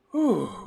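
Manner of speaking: loud, high sigh of relief; relieved whew. Real, not acted. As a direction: blow out the tension. Relief again.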